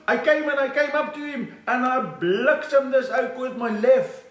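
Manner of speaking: high, slow boxer proudly recounting; impression of Gerry Coetzee. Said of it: "How did you do it, Gerrie?".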